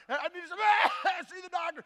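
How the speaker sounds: loud mock coughing fit mid-sentence; hammed-up sick patient; coughing while talking. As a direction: cough your way through the words. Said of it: "he can't stop coughing".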